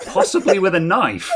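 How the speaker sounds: loud, high-pitched incredulous comeback; mocking disbelief. Shop owner answering a scammer's death threat, incredulous. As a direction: challenge the threat with rising ridicule.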